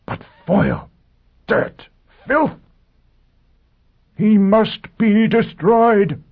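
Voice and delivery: shrill, slow rising hysteria; soaring voice. Acted. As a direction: let the voice climb out of control. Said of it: "Pristine's voice soared alarmingly".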